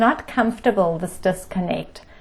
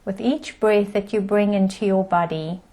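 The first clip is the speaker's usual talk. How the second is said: guided meditation. Acted. Teacher settling the group into breath and body, guided meditation. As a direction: ease listeners into stillness, calm and unhurried.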